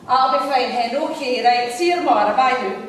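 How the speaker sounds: loud Coatbridge caller; Coatbridge accent. Retold or imitated. Caller objecting to the menu, loud Coatbridge accent. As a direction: imitate a Coatbridge woman, loudly objecting. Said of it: "in her best Coatbridge accent".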